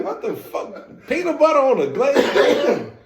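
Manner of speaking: high incredulous outburst, ending in laughter; mock disbelief. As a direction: balk at it, hammed-up and amused. Acts out his reaction to odd snack.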